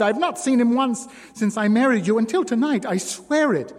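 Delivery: high, loud, fast tearful protest; sobbing denial. A narrator's reading. Wife denies the affair to her husband, sobbing. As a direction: plead through tears. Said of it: "she sobbed".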